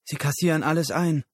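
high, loud, fast outburst; seething anger. Acted. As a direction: burst out indignantly. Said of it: "Susan kochte vor Wut". (wütend und schnell hervorgestoßen)

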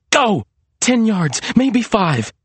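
high, pressing inner voice; urgent self-urging. A narrator's reading. Maria racing from Lester toward the house, urgent. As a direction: count down the escape urgently.

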